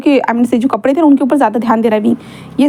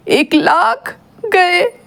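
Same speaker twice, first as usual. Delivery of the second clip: high, very slow drawn-out wail over the ruined shirt; anguished cry; 6 semitones higher, much slower than usual. A narrator's reading. Wing wails over his ruined expensive suit, drawn-out and high. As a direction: let it stretch out like a mock lament. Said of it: "जोर से चिल्ला कर बोलता है".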